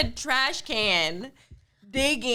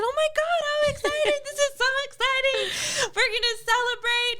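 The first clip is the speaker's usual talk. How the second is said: high, gushing over-the-top joy; gushing excitement; 11 semitones higher than usual. Retold or imitated. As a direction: squeal it like a thrilled mom. Mom gushing over lost tooth.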